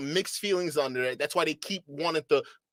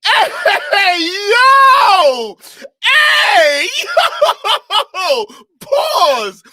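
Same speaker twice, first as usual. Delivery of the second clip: screaming high and loud in shocked disbelief; hysterical shouting; 14 dB louder, much slower than usual. Real, not acted. Streamer shrieking at the clip in disbelief, laughing shouts. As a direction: howl it out like you can't believe your ears.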